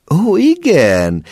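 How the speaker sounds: joyful exclaim; happy cry. A narrator's reading. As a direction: cry out in relief, understanding at last. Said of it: "kiáltott mama boldogan".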